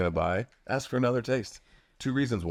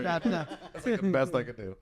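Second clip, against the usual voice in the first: laughing; 10 semitones higher, much slower than usual. Real, not acted. Laughing after failed accent.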